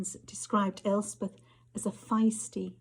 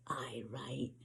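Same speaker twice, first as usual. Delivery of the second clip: sneer; 8 semitones lower, 9 dB quieter than usual. Acted.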